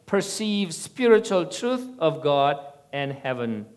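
measured, projected reading of a testimony; sermon read-aloud. A narrator's reading. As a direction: read it out to a congregation from a script. Reads out prepared sermon testimony.

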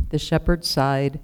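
ordinary delivery, nothing marked

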